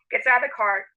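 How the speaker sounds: usual speaking voice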